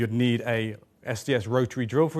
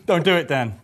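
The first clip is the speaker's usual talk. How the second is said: laughing; 3 semitones higher, 6 dB louder than usual. Real, not acted. Laughing plea during outtake.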